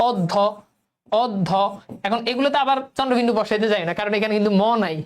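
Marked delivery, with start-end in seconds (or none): 0.1-1.5 অধ্য split as "od-dho", opening on o; doubled d. Shown as a demonstration. Teacher answering the pronunciation question, অধ্য as "od-dho". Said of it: "উচ্চারণ হবে কি".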